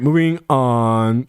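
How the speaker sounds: usual speaking voice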